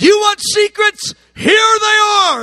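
Jesus boldly answering John's cry for secrets, shouted; triumphant shout. Retold or imitated. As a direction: shout it out like handing over a treasure. Jesus answering John's bold request.

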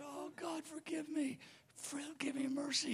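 very soft, high whimper; imitated tearful pleading. Preacher mimics the fallen man begging God, soft high whimper. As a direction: plead weakly in a high, hushed voice.

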